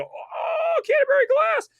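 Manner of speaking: very high, delighted recognition; excited film-nerd recognition squeal; Leo pointing reaction. Retold or imitated. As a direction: gasp it out like the pointing Leo meme. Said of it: "I did the, the Leo".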